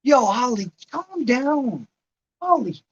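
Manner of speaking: high-pitched, exclaimed call to settle down. Real, not acted. Streamer telling Holly in chat to calm down, calling out.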